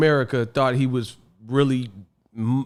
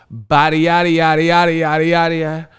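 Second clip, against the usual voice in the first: louder, higher singsong gibberish; mock babble; 3 semitones higher, 5 dB louder than usual. Mocking UK fighters, loud rhythmic babble. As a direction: run the nonsense syllables together.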